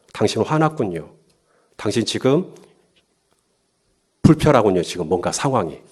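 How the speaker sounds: soft, low, pausing reflection; softly name the other person's feeling; gentle empathy. Acted. Modeling empathic reading of anger. (나직이 공감하며 읽어주는 말투)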